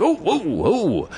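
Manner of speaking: high, drawn-out imitation of an alarmed man; slow, high-pitched mock protest. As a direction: draw out the whoas, high, imitating his alarm. Old man alarmed at the jar.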